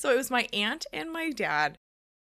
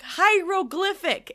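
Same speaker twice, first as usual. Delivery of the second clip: high, loud, slow attempt; acting out a determined retry; insistent retry; 8 semitones higher, 8 dB louder, slower than usual. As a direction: sound it out loudly, syllable by syllable.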